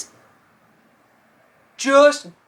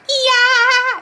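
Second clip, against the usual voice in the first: high, loud imitated cheer; mock crowd scream; excited crowd cheer; 5 dB louder than usual. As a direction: whoop like a crowd as the lights dim. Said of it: "everyone's like".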